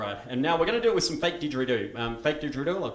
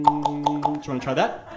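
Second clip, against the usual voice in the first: ordinary talk, nothing marked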